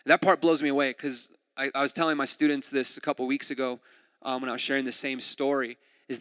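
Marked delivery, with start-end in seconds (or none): none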